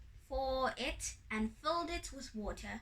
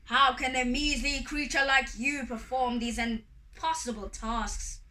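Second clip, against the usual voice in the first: loud, booming indignation; loud imperious challenge; sky god roar; 6 dB louder than usual. A narrator's reading. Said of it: "he roared".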